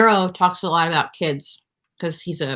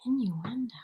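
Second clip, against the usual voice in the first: soft whispered, thinking aloud; whispering; 3 semitones higher, 12 dB quieter than usual. Real, not acted. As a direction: whisper the word to yourself. Player whispers the word back.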